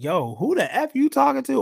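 high, loud mock confrontation; imitating an adult snapping back; angry adult. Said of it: "they would have been like".

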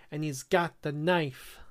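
usual speaking voice

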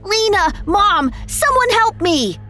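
put-on high, frantic child's call; screaming for help. A narrator's reading. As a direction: call desperately for mother and nanny. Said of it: "he screamed".

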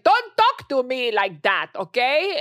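put-on angry mom's Spanish-accented scolding, loud and slow; thick Spanish accent. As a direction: imitate mom's heavy Spanish accent in English. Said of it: "she has a very thick s-, a-, Spanish accent".

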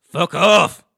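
loud, high blast; yelled brush-off. Acted. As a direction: bark it at the caller. Host yells at caller.

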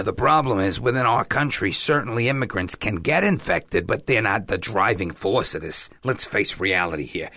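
a half-hearted Fauci impression, dropping low. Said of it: "I'm not gonna do my whole Fauci impression".

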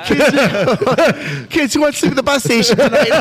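high, hammed-up parental call; calling the kids. Parent getting kids out for the night, mock calling out. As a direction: sing out to the kids as a joke.

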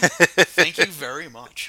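loud, slow, beaming thank-you; excited thanks. Real, not acted. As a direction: gush with gratitude. Thanking for the gift.

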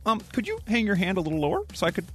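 high, polite little dog voice; a pet dog's timid, well-mannered voice. Retold or imitated. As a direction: voice the dog asking sweetly. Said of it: "So that's Roscoe".

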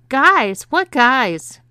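loud, high incredulous outburst; shocked question. A narrator's reading. Husband shocked by news of other men.

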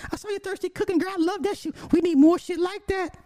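high squealing fan voice praising her; gushing fan. Retold or imitated. Couple cheering Hillary's thirsty cooking, in a high squeal.

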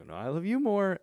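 high, swooping affectionate comeback; playful one-upping. Retold or imitated. Playful love-you contest with son.

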